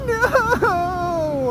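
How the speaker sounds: long, high mock wail, sliding down; crying like an infant. Drunk singer refusing his bandmate, mock crying wail. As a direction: imitate a drunk man bawling like a baby. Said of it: "this is how he's acting".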